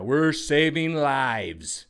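slow, drawn-out slogan; mock official line. Mocking officials' pandemic slogan, slow and drawn out. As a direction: intone it like hollow propaganda. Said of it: "use the same guys".

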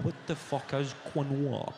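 ordinary delivery

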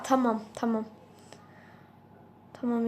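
ordinary delivery

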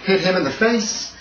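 ordinary talk

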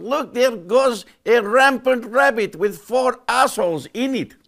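high, loud, slow mock-Russian shout; hammed-up Russian voice, drawn out and pitched high; onlooking Russians. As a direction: play an astonished Russian watching the sky. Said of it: "All the Russians looking up".

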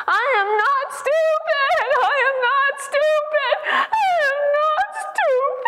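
very high, slow, drawn-out wail acted out; imitated crying yell. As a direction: act a woman crying out uncontrollably, words stretched high. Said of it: "crying out and yelling at the top of her voice".

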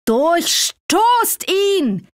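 high, slow, drawn-out furious order; shaking with rage. A narrator's reading. Sultan ordering his guards to stab Assad, trembling with rage. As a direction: hurl the command out, trembling with fury. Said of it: "zitternd vor Wut".

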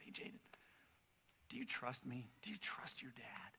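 quiet, low fatherly coaxing; hushed reassurance. Retold or imitated. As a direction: drop to a soft, low near-whisper. Father calmly coaxes panicking son.

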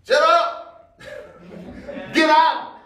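hammed-up command to the demon, laughing; mock rebuke. Jesus ordering the demon out, a half-laughing mock shout.

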